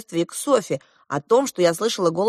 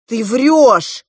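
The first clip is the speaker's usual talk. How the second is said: loud outburst of disbelief; shouted accusation; 3 semitones higher, 7 dB louder than usual. A narrator's reading. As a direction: throw the accusation out loudly. Captive girl shouts an accusation. (громкое возмущённое обвинение)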